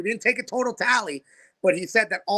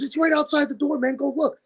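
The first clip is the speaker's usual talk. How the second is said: higher-pitched, imitated eager wrestler; retold excited urging; 7 semitones higher than usual. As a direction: urge the boss to go and see, in a retold voice. Wrestler urges boss to look.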